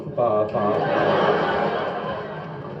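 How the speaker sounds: low, softening run of stuck syllables; mock stammer trailing downwards; comic repeated P; stuttering along. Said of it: "and the boss gone".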